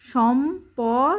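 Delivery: সম্পর drawn out slowly as "shom... por"; slow teacher's "shom-por"; syllable-by-syllable spelling. Shown as a demonstration. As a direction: sound out সম্পর, closing on a clear r. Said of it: "রেফের উচ্চারণ কি বলছিলাম".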